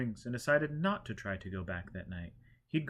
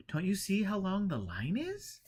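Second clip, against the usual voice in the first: woman in line; 9 semitones higher than usual. A narrator's reading. Woman replies laughing.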